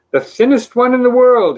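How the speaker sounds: high, loud and chipper; a cheery boast from the thin man; bright. A narrator's reading. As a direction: beam it out proudly. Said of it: "he replied brightly".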